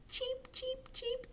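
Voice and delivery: very high little chirps; high, evenly spaced cheeps; cheeping baby chicks. Pretend.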